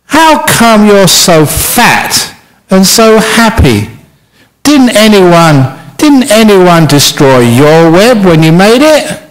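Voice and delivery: sad spider asking. Acted. Sad spider questions the fat spider, slow and lifted. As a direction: ask slowly, lifted and puzzled. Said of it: "the suicidal spider said".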